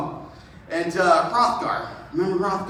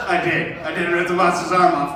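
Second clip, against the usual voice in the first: lightly voiced hero; 4 semitones lower than usual. Beowulf answers his men.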